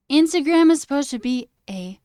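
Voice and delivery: loud, high annoyed rant; frustrated outburst; heated rant. Real, not acted. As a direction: vent loudly about the app. Ranting about Instagram.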